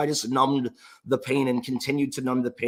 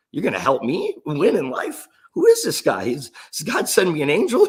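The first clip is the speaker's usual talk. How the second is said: incredulous excitement; 4 semitones higher, 5 dB louder than usual. Retold or imitated. Excited disbelief at the offer.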